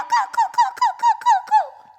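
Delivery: put-on very high, squeaky baby-bird caws; chicks cawing. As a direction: peep like hungry chicks. Hungry chicks calling.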